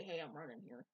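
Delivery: low, soft hint of New York accent; understated New York accent. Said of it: "Sonic to have a New York accent".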